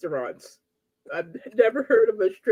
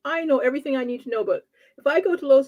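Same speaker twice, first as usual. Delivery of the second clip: ordinary talk, nothing marked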